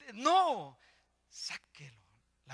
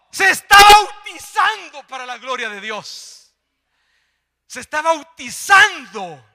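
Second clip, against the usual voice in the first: shouted, high-pitched proclamation; full-force preaching; 9 semitones higher, 22 dB louder than usual. Real, not acted. Preacher announcing his grandson's baptism, full-force preaching. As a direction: shout the blessing to the whole congregation.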